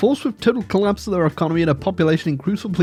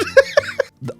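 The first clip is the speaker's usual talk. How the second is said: laugh. Real, not acted. High squealing laugh.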